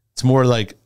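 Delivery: ordinary talk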